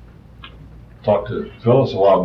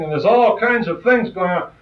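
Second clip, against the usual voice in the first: ordinary delivery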